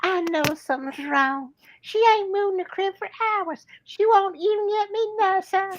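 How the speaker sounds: high-pitched worried wife in bed; worried mother. A narrator's reading. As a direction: voice the sick mother high and pleading. Feverish mother voiced in story.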